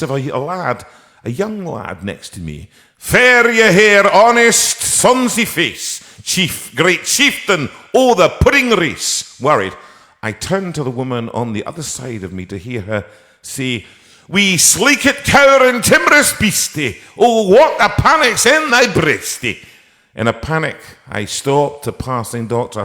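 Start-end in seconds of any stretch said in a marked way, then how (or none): 3.0-9.4 put-on a young lad's high, loud Scottish brogue, spoken slowly; Scottish accent. Young lad in the waiting room reciting Burns in a Scottish accent. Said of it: "try your wee accent out". 14.3-19.6 put-on a woman's high, loud Scottish accent. Woman in the waiting room reciting Burns in a high Scottish accent. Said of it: "try your wee accent out".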